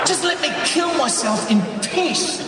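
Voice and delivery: high, loud yelling at the phone; exasperated yell. Acted. Husband yelling at the phone at his wife, exasperated shout. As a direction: explode at the caller, stretching the words out. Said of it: "I yell at the phone".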